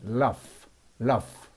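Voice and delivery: "rough" ending in an F sound. Shown as a demonstration. Teacher models a word for learners, "rough" with final GH as F. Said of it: "지에이치도 F 발음이 납니다".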